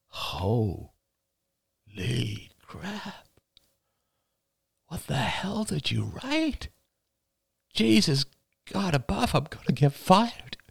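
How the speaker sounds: high, strained disbelief, starting hushed; slowly dawning horror while reading the messages; aghast. Acted. Reads her messages, horrified.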